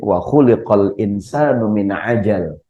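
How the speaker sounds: slow recited Arabic verse; Quran recitation. Shown as a demonstration. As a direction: intone the verse slowly, like reciting. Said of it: "Ada ayat lain".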